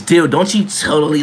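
ordinary talk, nothing marked

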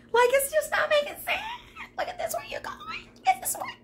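very high, squeaky choked voice; overcome squeal. Real, not acted. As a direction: squeeze the words out in a squeal. Too overcome to get words out.

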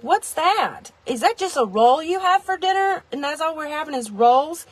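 high-pitched mock puzzlement; puzzled dinner guest. Guests questioning a plain roll for dinner, puzzled. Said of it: "People would be like".

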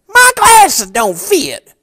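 disguised complaining customer. Retold or imitated. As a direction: fake a whiny customer to tease an old friend. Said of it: "here's exactly how I said it".

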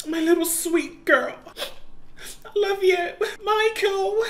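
tearful goodbye. Acted. Dying girl's goodbye.

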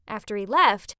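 ordinary delivery, nothing marked

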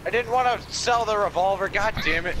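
exasperated outburst; frustrated. Real, not acted. Frustrated at selling revolver.

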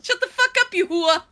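loud, high Sopranos-style yell; New Jersey accent. Acted. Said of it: "Now call me a hua".

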